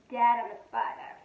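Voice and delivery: ordinary delivery, nothing marked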